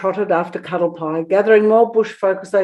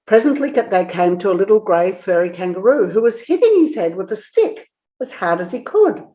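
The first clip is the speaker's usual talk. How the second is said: ordinary delivery, nothing marked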